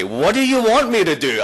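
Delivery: usual speaking voice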